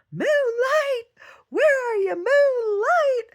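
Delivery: calling the lost dog. Retold or imitated. Owner calling his lost dog Moonlight, loud distant call. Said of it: "heard somebody calling".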